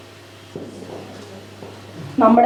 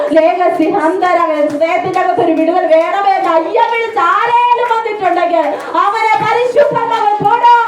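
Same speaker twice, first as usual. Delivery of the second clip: building prophetic preaching; 6 semitones higher than usual. Acted. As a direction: pitch climbing higher into full fervor.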